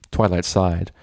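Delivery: plain reading voice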